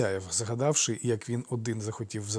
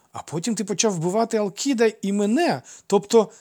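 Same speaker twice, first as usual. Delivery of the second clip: put-on high, loud boy losing his thread; confused childish protest; muddled child; 9 semitones higher, 6 dB louder than usual. A narrator's reading. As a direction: argue eagerly, then get tangled. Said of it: "Він зовсім заплутався".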